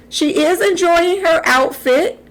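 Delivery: usual speaking voice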